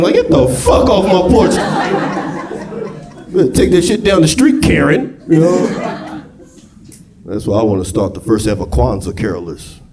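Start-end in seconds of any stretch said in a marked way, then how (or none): 0.0-5.7 mock shooing. Homeowner chasing carolers off his porch, mock annoyed. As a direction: run the carolers off.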